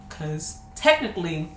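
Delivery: usual speaking voice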